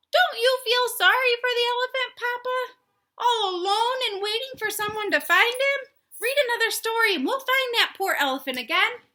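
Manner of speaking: high, loud, wheedling chicken voice. Acted. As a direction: plead sweetly like a little chicken. Chicken pleads with Papa.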